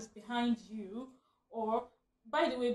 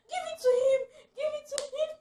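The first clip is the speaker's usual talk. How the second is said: high shrieking yell, much louder and higher; retold shout; 7 dB louder than usual. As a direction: scream across to the husband to let go. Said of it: "Shouting from the other side".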